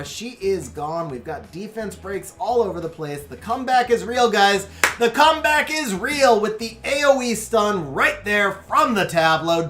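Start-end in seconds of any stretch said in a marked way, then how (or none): 3.2-9.7 excited. Real, not acted. Comeback hype call.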